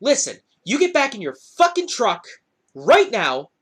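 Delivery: acted angry ultimatum. Teller ordering driver back into truck, acted angry ultimatum. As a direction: act out ordering someone off.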